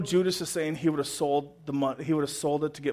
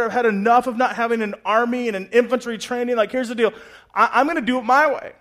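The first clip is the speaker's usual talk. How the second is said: loud, higher, indignant Judas; fed-up Judas; 6 semitones higher, 10 dB louder than usual. Acted. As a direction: spit out his grievances at Jesus. Judas voiced fed up with Jesus.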